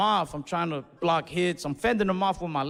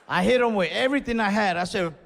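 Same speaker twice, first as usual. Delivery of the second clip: usual speaking voice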